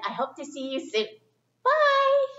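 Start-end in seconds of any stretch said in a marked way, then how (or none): 1.6-2.3 puppet voice. Pretend. Puppet says bye high.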